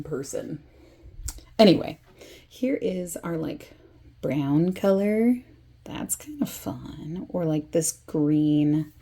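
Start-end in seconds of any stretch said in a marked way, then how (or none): 5.8-7.3 whispering. Real, not acted. Slow whispered aside.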